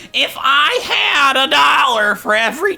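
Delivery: high, loud, drawn-out mock complaint; exasperated little old-man voice. Librarian grumbling about missing library cards, high slow little voice. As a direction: wail it slowly as a tiny-voiced librarian. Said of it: "And it's just like".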